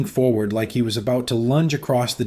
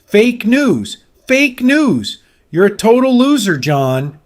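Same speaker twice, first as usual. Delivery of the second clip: much higher, louder furious yelling; screaming; 10 semitones higher, 6 dB louder, slower than usual. A narrator's reading. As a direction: scream it louder each time. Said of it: "louder each time".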